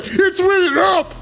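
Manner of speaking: squeaky hyped-up bro yelling at his buddy; put-on hype bro voice. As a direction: yell it like a hyped frat bro.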